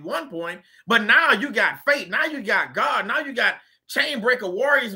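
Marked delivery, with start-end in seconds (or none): none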